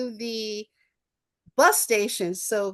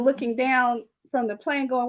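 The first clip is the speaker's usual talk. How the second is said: ordinary talk, nothing marked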